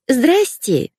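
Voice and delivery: put-on high, squeaky girlish voice. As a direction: squeak like a silly young girl. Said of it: "запищала я".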